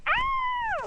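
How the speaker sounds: very high short scream; a friend screaming in surprise; startled shriek. Retold or imitated. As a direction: shriek at a sudden fright.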